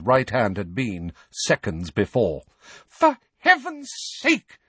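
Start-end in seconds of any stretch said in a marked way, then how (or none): 2.9-4.6 very high, drawn-out exasperated scream. A narrator's reading. Mother after the twins' dishes crash, screaming. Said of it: "screamed Missus Weasley".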